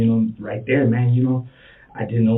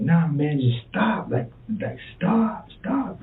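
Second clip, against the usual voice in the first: rising, much higher pleas of stop; pleading stop; 8 semitones higher, slower than usual. Retold or imitated. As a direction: climb higher with each desperate stop. Retelling begging her to stop.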